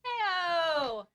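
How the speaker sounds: bright, loud, drawn-out hello; cheery greeting. Acted. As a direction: greet him like an old friend. Bard greets the caravan master.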